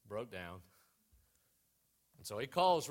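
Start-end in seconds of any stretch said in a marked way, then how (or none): 0.1-0.6 "broke down" dropped low and soft; sudden deflated letdown; deadpan drop. Shown as a demonstration. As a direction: let the punchline fall flat and quiet. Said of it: "ever, ever break down".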